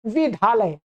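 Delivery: विद्यालय as vidale, y cluster lost; wrong pronunciation. Retold or imitated. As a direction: imitate what people say: vidale. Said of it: "सुना मैंने". ("विद्यालय" गलत, "विदाले" जैसा)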